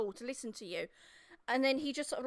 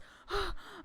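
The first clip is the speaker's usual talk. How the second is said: high frightened gasp; mid-sentence gasp; 7 semitones higher than usual. Acted. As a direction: catch your breath in panic. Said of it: "young lad runs up".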